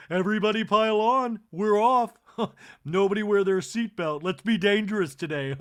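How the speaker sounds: loud, high, rallying cry; giddy Kathy. Retold or imitated. Kathy rallying the ladies into the carts, loud. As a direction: whip the group up for mischief. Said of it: "And Kathy goes".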